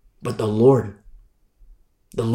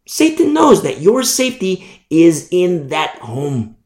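normal talking voice

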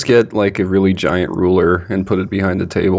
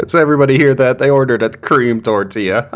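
mocking announcement; 5 semitones higher than usual. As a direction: call it out to mock them. Worker announces mocking customer.

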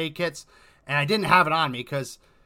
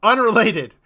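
loud, high re-enacted disclaimer; urgent disclaimer; 8 semitones higher, 10 dB louder, slower than usual. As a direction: call it out to stop the rescuers. Waving off mistaken helpers in story.

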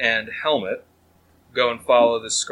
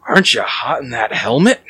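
bandana man voice. Acted. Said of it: "and he says".

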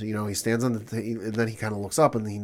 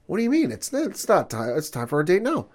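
much higher, confused insistence; high baffled protest; 8 semitones higher than usual. Retold or imitated. Confused man insisting to his date that it's time, high. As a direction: plead that you're on time.

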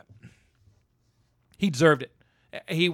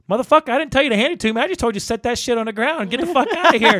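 fast, louder, higher mock tirade; angry rant; 6 semitones higher, 5 dB louder, much faster than usual. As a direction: rattle off an angry telling-off. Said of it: "He goes, basically like".

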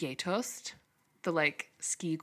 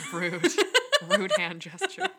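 laughing while talking; 9 semitones higher, 5 dB louder than usual. Real, not acted. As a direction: say it through a laugh. Laughing at a joke.

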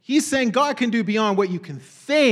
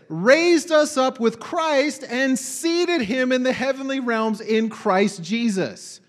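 slow emphatic scripture reading; 4 semitones higher, slower than usual. Acted. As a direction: draw the verse out slowly, weighing each word. Reads verse slowly.

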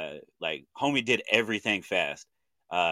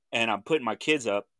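usual speaking voice

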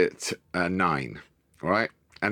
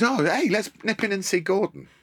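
cheery dad; 11 semitones higher than usual. Retold or imitated. Dad's chirpy suggestion, retold.

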